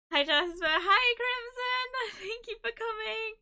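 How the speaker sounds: high, squealy welcome to arriving friends; excited greeting. Real, not acted. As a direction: greet them in a high, delighted pitch. Welcoming viewers who just arrived.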